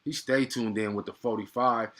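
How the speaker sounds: ordinary talk, nothing marked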